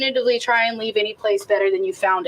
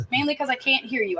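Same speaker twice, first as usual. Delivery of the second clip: ordinary talk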